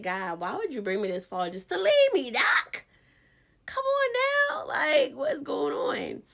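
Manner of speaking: high, loud cry of frustration, half laughing; crying out to God. Retold or imitated. Crying out to God over feeling abandoned, high and loud. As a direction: wail at God, why leave me here. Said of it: "It's like".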